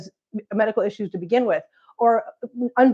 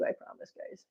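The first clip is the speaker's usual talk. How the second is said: whisper; 16 dB quieter than usual. Real, not acted. Whispered promise to viewers.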